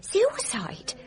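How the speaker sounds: a sharp high whisper of disbelief; shocked disbelief. Acted. Nurse to Poirot, startled by the suicide idea, whispered high. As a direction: gasp the word out, appalled.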